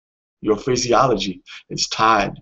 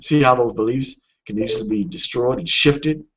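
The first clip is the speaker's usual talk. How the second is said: usual speaking voice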